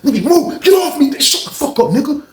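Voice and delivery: very high strained mock yelling; heated high-pitched struggle, imitated; fighting while choking someone. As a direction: grunt out orders mid-tussle.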